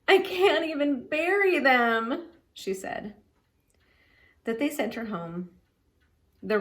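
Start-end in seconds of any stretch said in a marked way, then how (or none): 0.1-2.3 higher, slow, tearful complaint; a little girl mourning her mice, mildly weepy. A narrator's reading. Girl mourning her pet mice, tearful. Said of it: "Ramona cried and carried on so".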